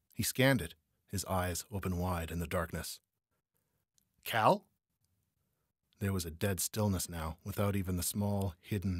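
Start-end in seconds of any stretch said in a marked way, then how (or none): 4.2-4.7 raised, rising call into the dark; questioning call. A narrator's reading. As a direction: call out for your missing partner. Searching for missing partner.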